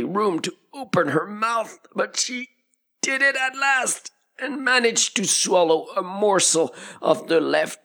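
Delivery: high, squeezed strained voice; straining to speak. A narrator's reading. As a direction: talk high as if your chin is jammed. Narrator acts out Alice's squeezed mouth.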